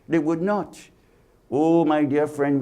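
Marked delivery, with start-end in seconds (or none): none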